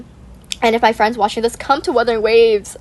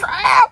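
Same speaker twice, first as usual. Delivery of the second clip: excited yelp; 11 semitones higher than usual. Real, not acted. Sudden realisation outburst.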